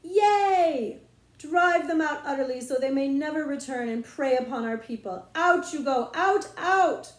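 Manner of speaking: high, loud triumphant shouting; battle cry. Acted. Queen chasing off invaders, loud high triumph. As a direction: drive the foe out with a rallying yell. Queen routing imaginary foes.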